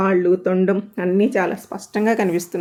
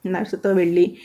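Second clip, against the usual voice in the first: ordinary delivery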